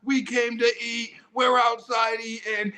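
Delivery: high, slow race-day cheering shout; cheering yell. Retold or imitated. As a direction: hype up the runners like a cheerleader. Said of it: "and I'm yelling".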